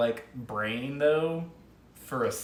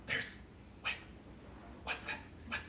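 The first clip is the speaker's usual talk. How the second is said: whispering; 15 dB quieter than usual. Acted. Snake whispering to Yizik.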